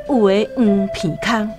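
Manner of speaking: ordinary talk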